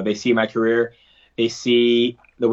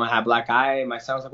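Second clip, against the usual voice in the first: usual speaking voice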